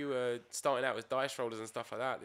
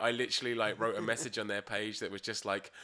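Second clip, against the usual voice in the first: ordinary talk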